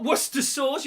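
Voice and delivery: Worcester as "wuss-ter", high and emphatic; high-pitched "wuss-ter sauce" for Worcester sauce; wrong short name. Shown as a demonstration. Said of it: "You don't call it Worcester sauce".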